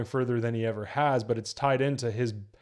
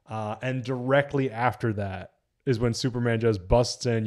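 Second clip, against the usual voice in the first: ordinary talk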